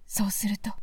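hushed, afraid princess; fearful whisper. Acted. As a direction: whisper it so the ogre won't wake. Frightened princess, ogre asleep nearby. (鬼を起こさぬようひそひそ声で)

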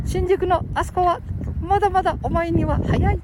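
high-pitched put-on character voice; high, small-voiced caution; mock warning. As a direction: explain it in a high puppet voice. Acts out older man's warning.

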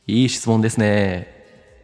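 drawn-out TV-host approval, slowed down; Akira Ikegami catchphrase. Shown as a demonstration. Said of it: "いい質問だったら僕が".

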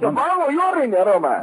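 high, forceful angry demand; real heated shouting that hurts the ear; very loud voice. Real, not acted. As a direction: yell it into the phone. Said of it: "声がでかいよ".

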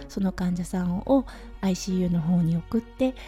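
usual speaking voice

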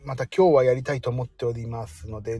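ordinary talk, nothing marked

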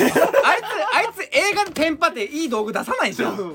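giggling while teasing the pick; laughing teasing comeback; laughing rebuttal. Real, not acted. Laughing rebuttal about Doraemon.

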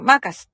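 マーカス shouted out with a long first vowel; loud, energetic マーカス; circus-like MARCUS. Shown as a demonstration. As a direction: belt マーカス like announcing a circus. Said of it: "サーカスみたいなテンションで".

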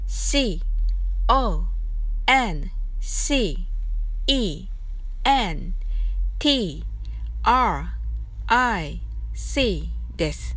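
letter by letter, slow and clear; spelled out slowly. Shown as a demonstration. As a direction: spell it out for learners. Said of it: "コンセントリックは同軸のという意味で". (一文字ずつゆっくり綴りを読む)

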